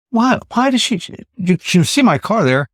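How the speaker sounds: high-pitched, baffled questioning; retold bewilderment. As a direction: replay your disbelief, pitched well up. Retold bewildered inner questioning at stranger.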